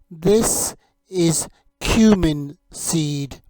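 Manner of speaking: slow, deliberate "This is cumin seed."; slow teaching model. Shown as a demonstration. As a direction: spell out "This is cumin seed." slowly for learners. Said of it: "You say it".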